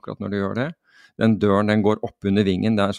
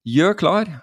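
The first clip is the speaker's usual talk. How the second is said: high-pitched retold command; acted instructor's command; jump command; 9 semitones higher, 3 dB louder than usual. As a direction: call out the ready order, pitched up. Jump command retold once more.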